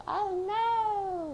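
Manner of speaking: very high hammed-up cry; mock girlfriend's dismay. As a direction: shriek it like a soap opera.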